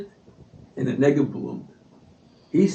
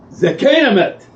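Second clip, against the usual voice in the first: loud, high defiant shout; shouted retort; 8 semitones higher, 4 dB louder, much faster than usual. Retold or imitated. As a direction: fling the answer back hard. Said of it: "He takes a book".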